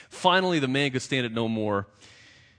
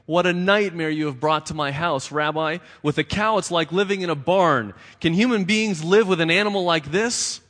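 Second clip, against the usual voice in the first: loud, higher, wailing complaint; crying and wailing; 6 semitones higher, 6 dB louder than usual. A narrator's reading. Poor man wailing to the rabbi about the cow. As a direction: wail your misery at him. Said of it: "crying and wailing".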